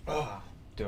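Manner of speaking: ordinary talk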